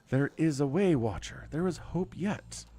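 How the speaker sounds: lightly voiced goddess, hopeful. Reads the goddess's lines aloud.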